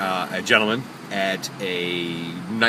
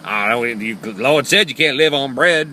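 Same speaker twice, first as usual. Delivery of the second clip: horrible caricature; 3 semitones higher, 6 dB louder, slower than usual. Retold or imitated. Driver imitating homeless lady refusing bread, slow caricature. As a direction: drag it out in her exaggerated voice. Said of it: "that's exactly how she sounded".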